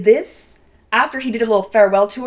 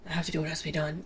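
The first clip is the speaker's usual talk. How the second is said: hollow, hardened; 5 semitones lower, 15 dB quieter, much faster than usual. Pretend. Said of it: "hollow, hardened".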